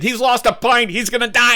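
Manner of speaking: put-on high-pitched alarmed kid voice; panicked classmate. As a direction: blurt it out in mock panic. Classmate panics over injured boy.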